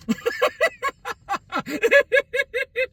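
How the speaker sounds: a real, high-pitched laugh. Real, not acted. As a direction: laugh warmly at the memory. After high five story.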